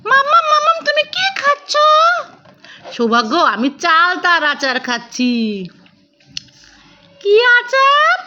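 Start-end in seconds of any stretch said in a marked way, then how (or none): 0.1-1.7 put-on much higher, a small child begging for the balloon; toddler voice. Mother voicing her toddler asking for the balloon, high childlike plea. 7.2-8.2 put-on much higher, a small child begging for the balloon; toddler voice. Mother voicing her toddler asking for the balloon, high childlike plea.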